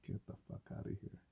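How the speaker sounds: unvoiced scoffing whisper; hushed get out of here; whispered dismissal. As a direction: brush it off in a whisper. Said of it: "I'm like".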